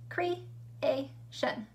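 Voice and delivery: "Creation" split as cre, a, tion, with pauses; chunked into syllables. Shown as a demonstration. Teacher showing pupils three syllables, "Creation" chunked with gaps. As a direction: say "Creation" in three separate beats. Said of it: "how the word is chunked out".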